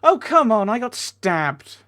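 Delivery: loud, high outburst of indignation; exasperated protest. Real, not acted. Player protesting to the game about being stabbed, exasperated. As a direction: protest it loudly, dragging out the last words.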